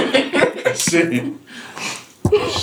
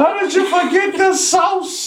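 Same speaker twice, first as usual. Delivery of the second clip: incredulous; 13 semitones higher than usual. Real, not acted. Teasing about forgotten sauce.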